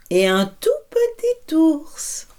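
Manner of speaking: slow high voice for the tiny bear. A narrator's reading. Bears from big to small.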